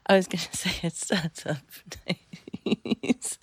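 loud, slow words breaking into laughter; real giggly, drawn-out delivery; laughing mid-sentence. Real, not acted. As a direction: laugh helplessly through the words.

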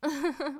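sharp gleeful laugh; loud laugh. Acted. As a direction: burst out laughing. Loud laugh, pants removed.

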